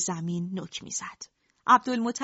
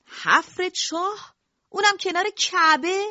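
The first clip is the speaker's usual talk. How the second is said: high, slow indignant disbelief; outraged protest; 8 semitones higher, 5 dB louder than usual. Acted. Rival elders protesting the digging beside the Kaaba, high indignant disbelief. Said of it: "اعتراض کنان".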